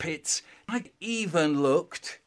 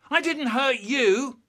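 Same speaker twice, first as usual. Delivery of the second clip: high, slow protest; indignant denial; 8 semitones higher than usual. Acted. Protesting in playground fight.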